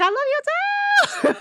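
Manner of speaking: high, laughing shout of affection; gushing laughing reply. Retold or imitated. Classmate returning her greeting, laughing high shout. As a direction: squeal it back with a laugh. Campus friends answering back.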